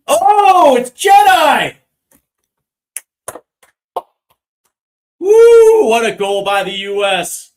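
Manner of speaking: screaming the goal, very high and loud; long goal yell. Real, not acted. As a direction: stretch the goal call out at full volume. US equalizer goal called live.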